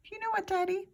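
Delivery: put-on child voice. Child voice to Dad.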